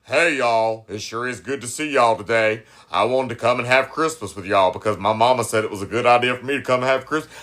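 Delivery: put-on very low, monotone straight-man voice. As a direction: force your voice way down to pass as straight. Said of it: "with a deeper voice".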